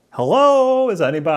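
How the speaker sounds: acted searching holler; calling out. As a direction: call out to see who is there. Acts calling out from inside head.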